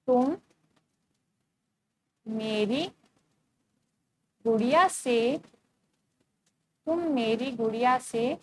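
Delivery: put-on dictating to young pupils. Shown as a demonstration. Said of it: "मत का प्रयोग करना है".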